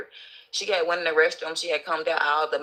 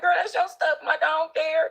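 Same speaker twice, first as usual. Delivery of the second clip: reassuring friend; 11 semitones higher than usual. Retold or imitated. Reassuring the woman.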